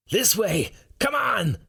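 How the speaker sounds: high-pitched urgent call to run; calling the others away from the snakes; shouting. Acted. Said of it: "Roar shouts".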